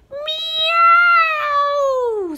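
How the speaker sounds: high, loud screeching meow; shrill feline yowl of pain; cat screech. A narrator's reading. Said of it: "screeched the cat".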